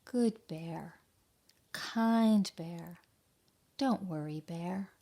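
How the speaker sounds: gentle storybook reading voice; story narration. A narrator's reading. Teacher reading a picture book to children, gentle narration. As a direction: read it like a bedtime tale to kids.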